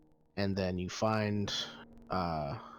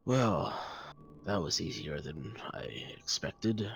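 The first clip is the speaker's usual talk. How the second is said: sighing; 4 dB quieter than usual. Acted. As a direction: let the words sink away on a sigh. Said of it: "he sighs and says".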